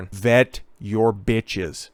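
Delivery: each word slowed and pressed, Vet hit hardest with a falling close; slow emphatic warning. Real, not acted. Host warning his viewers, slow and stern. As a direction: drag out "Vet your bitches" like a stern final verdict. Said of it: "slow emphatic warning".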